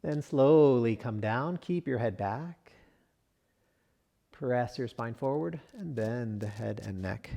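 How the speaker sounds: usual speaking voice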